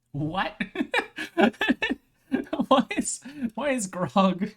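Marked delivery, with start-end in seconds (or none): 0.7-2.0 sudden high-pitched burst of laughter; high squealing laugh. Real, not acted. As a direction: shriek of laughter, loud. Laughs at grog meaning.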